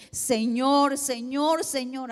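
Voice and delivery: a mother calling on God at dawn; fervent prayer cry. Retold or imitated. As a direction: cry out to the Lord, imploring.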